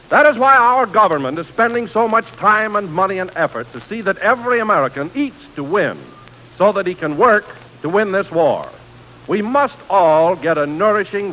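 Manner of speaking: ordinary delivery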